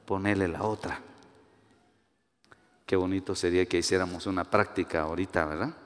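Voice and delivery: normal talking voice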